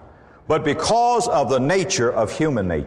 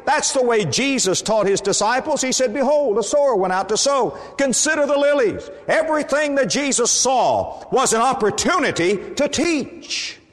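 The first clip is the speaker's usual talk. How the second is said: high, ringing pulpit voice with rising fervour; fervent preaching; 8 semitones higher than usual. Acted. Preacher rousing the congregation with Jesus's teaching, fervent pulpit voice. Preacher opening, raised pitch.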